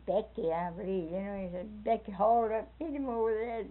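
ordinary talk

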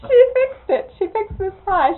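through laughter and tears, high and loud; half-crying with laughter; can barely get it out for laughing; laughing disbelief. Real, not acted.

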